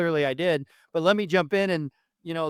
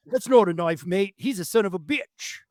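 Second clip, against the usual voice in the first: a hammed-up Aussie drawl, pitched up and slower at the end; mock Australian accent; 4 semitones higher than usual. Host quoting the guest's line in a mock Australian accent. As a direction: play the Crocodile Dundee knife line in an Australian accent. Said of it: "in his little Australian accent".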